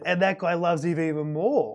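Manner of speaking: ordinary talk, nothing marked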